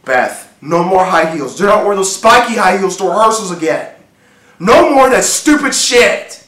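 firm command climbing to a loud, high bark; stern angry order. Acted. Husband ordering his wife to stop dressing up, increasingly angry. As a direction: lay down the law, harder with each order. Acted husband laying down the law.

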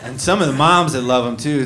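ordinary delivery

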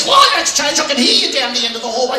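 high-pitched, shouted complaint; exasperated son. Retold or imitated. Son complaining to dad about noises at night, exasperated yelling. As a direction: yell it like a traumatised son.

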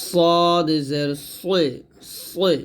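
সদ জের সই, very slow with heavy rounded s; thick sad. Shown as a demonstration. Teacher models sad for learners, heavy drawn-out সদ. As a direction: stretch সদ full and thick. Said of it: "সদ মোটা করে".

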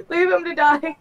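high voice breaking into laughter; laughing. Real, not acted. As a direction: laugh through the words. Laughing at doomed teammate.

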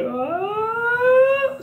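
long note sliding up into a very high register; voice cracking high. Shown as a demonstration. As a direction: let the held sound crack into falsetto. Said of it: "see how my voice just crack into high mode".

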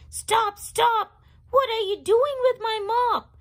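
high, loud, fast yell; yelling janitor. Acted. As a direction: call out to stop him. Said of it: "heard somebody yell".

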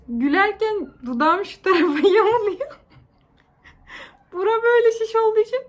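talking through giggles; laughing. Real, not acted. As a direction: laugh while explaining. Laughing about swollen lip. (gülerek)

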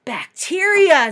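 Mister Melon voice. Acted. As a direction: stretch out bacteria like a quirky scientist. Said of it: "said Mister Melon".

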